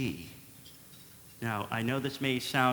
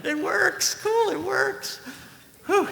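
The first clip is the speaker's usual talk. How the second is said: relieved; 3 dB louder, slower than usual. Acted. Relieved joke after song.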